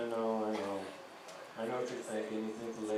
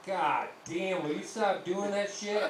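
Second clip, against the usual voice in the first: scolding dad; 6 semitones higher, 4 dB louder, slower than usual. Retold or imitated.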